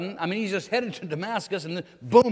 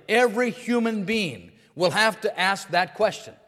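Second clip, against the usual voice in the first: louder, rising fervent preaching; preaching at full force; 3 semitones higher, 4 dB louder than usual. Acted. Pastor pressing the morning congregation, fervent preaching. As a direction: drive it home to the congregation.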